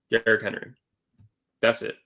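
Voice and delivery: ordinary delivery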